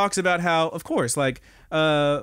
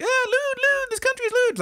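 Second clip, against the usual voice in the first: high-pitched mock hysteria; imitated freak-out, voice leaping way up. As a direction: squeal it as a scandalized character losing it. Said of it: "she's freaking out".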